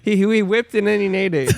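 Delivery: ordinary delivery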